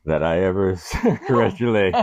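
seductive line collapsing into laughs; breaking into laughter. Acted. Impression cracking up into laughter.

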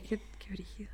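hushed whispered aside; whispering. Real, not acted. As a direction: breathe it out in a whisper.

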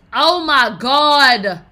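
slow, drawn-out loud oh my god; stunned. Real, not acted. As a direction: stretch it out in shock. Reacting to shocking headline.

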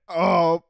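loud high chair exclamation; the chair yelps; cartoon chair cry; chair groan. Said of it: "it goes like".

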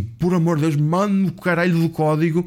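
retold sweary, exasperated demand; mock exasperation. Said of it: "Ele assim começa a se exaltar".

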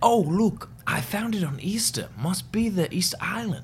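high cartoon Dutch explorer; mock Dutch accent. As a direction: play the Dutch guy, hammed up. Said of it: "But the Dutch guy says".

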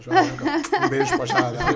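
high laughing shout-out to his mother-in-law. Real, not acted. Laughing while teasing his mother-in-law on the podcast. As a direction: laugh out a kiss to the Jararaca.

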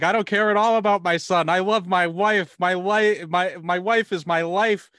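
loud, high melodramatic plea; mock pleading Gordon. Gordon begging Harvey to spare his wife, loud melodramatic plea. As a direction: ham up a lovestruck husband begging. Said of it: "he should have been like".

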